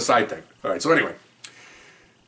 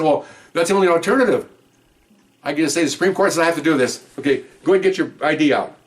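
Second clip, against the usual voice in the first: higher, louder sarcastic push; exasperated arguing; 7 semitones higher, 5 dB louder than usual. Retold or imitated. Officer arguing with the judge, exasperated sarcasm. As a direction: press the absurd point.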